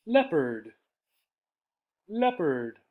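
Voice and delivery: ordinary talk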